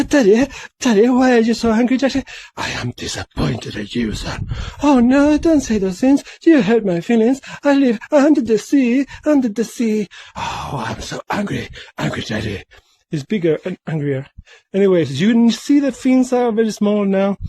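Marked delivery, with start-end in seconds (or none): 0.0-2.2 whiny son voice. Pretend. Child fish voicing to angry father. 2.6-4.5 stern father voice. Pretend. Father fish answers son, low. 4.8-10.1 whiny son voice. Pretend. Son fish replies to father. 10.4-12.6 grumpy father voice. Pretend. Father grumbles low.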